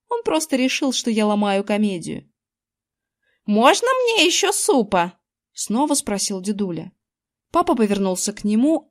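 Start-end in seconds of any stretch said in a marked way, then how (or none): 3.5-5.2 put-on high-pitched, plaintive old man's request; pleading grandpa. A narrator's reading. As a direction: ask for more like a hopeful grandpa. Grandpa begs for more soup. (просящий голос дедули, высоко)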